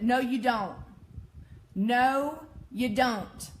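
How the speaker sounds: loud, firm contradiction; emphatic rebuttal. Real, not acted. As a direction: punch each word loudly, leaving a pause. Firm rebuttal to common claim.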